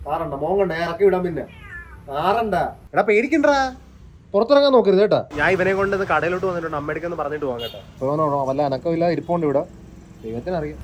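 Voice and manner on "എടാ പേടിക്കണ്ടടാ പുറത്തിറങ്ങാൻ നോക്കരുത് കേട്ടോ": higher, louder coaxing of a frightened cat; high, loud pet talk to a scared cat. Real, not acted. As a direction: reassure the cat, pitched up and loud.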